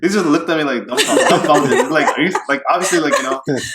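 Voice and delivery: real laughter in the words; laughing while telling. Real, not acted. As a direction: laugh through the story as you tell it. Laughing through own story of cop.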